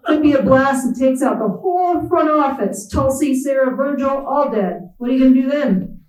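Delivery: lightly hammed-up Appalachian boss listing doom; Appalachian drawl. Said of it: "Appalachian drawl".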